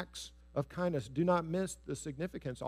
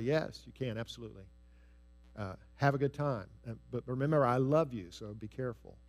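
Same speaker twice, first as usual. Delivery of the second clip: kind father; 4 semitones lower than usual. Acted. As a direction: give permission kindly, softening at the end. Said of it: "one has been kind".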